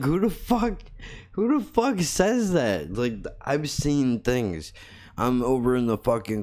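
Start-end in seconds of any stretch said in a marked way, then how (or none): 0.0-2.9 high-pitched mock disbelief; squeaky-high retold "are you serious" reaction; retold incredulity. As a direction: imitate his incredulous outburst, pitched way up.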